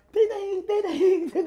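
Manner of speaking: high sung-out ding ding, laughing; phone ding imitation. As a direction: chirp each ding like a text alert. Imitating phone notification sounds.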